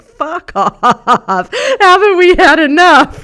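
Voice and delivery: fed up but cracking up; laughing fuck off. Retold or imitated. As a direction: flip the bird with a laugh. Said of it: "lift the bird to the air and go".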